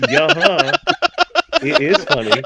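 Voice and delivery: high, loud, laughing insistence; mock argument. Writer arguing with the editor over a joke, loud laughing protest. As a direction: argue back like an indignant kid, half laughing. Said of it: "And I've argued".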